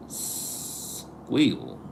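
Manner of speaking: "squeal" with a long hissed S before it; attempted pronunciation. Shown as a demonstration. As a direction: draw out the S in "squeal", then finish it. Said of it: "it sounds something like that".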